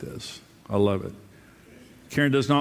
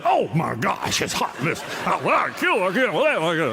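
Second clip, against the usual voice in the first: wife overheated; 4 semitones higher than usual. Retold or imitated. Wife coming home to hot house.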